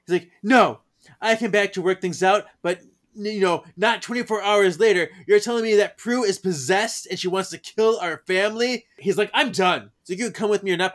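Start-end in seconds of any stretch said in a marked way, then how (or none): 0.4-8.9 higher, louder, indignant argument; mock-angry outburst, voice pushed up; exasperated husband. Acted quarrel, Henry fed up. 9.3-10.9 fed-up husband. Acted. Acted quarrel, Henry walking out.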